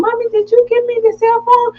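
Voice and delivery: put-on high eager childish question; little girl voice. Mother imitating daughter asking about phone, high kid voice. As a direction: mimic a kid asking excitedly. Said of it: "And she came home".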